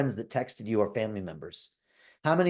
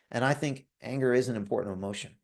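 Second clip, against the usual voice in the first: normal talking voice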